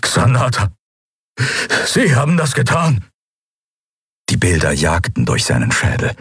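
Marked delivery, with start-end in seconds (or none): 0.0-3.1 high, strained voice of realisation; horrified accusation. Acted. Prisoner blaming the commodore for the shipyard attack, horrified. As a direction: rise in disbelief as you accuse. Telios accuses Monaro in horror.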